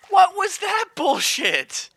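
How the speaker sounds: high loud yell; outraged yell. Real, not acted. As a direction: bellow in disbelief. Same words yelled earlier.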